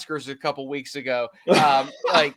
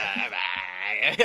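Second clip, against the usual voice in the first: laughing; 6 semitones lower than usual. Real, not acted. Laugh after impression.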